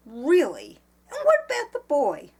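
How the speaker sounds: high, loud indignant questioning; angry demand. A narrator's reading. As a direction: challenge him, voice jumping up. Eleanor angrily confronts the calm man.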